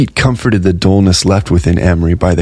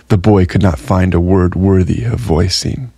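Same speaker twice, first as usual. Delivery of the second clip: ordinary delivery, nothing marked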